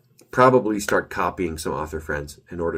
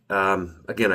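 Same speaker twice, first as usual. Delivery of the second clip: ordinary delivery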